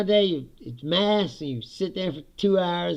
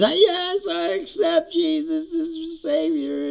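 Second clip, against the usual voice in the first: high, slow mock piety; mock pious acceptance; 5 dB louder, slower than usual. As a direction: stretch out a hammed-up conversion, high. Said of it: "he says".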